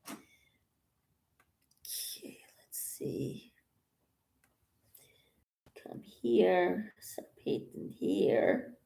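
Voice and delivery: soft unvoiced whisper to herself. Real, not acted. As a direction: whisper it, barely audible. Quietly painting, talking to herself.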